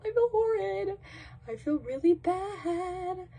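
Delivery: high, thin whine; high-pitched remorse, slowing at the end; guilty whining. Real, not acted. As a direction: draw it out in a high guilty wail. Guilty whining over her blunder.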